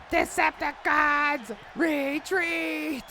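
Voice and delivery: very high, drawn-out screechy retreat order; hammed-up robot villain command; cartoon villain cry. Acting out a Transformers villain's catchphrase.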